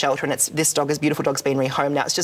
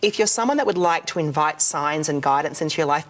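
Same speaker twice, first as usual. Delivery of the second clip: ordinary talk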